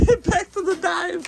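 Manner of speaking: high, giggling through the words; laughing. Real, not acted. Shopper trying to move on while still laughing.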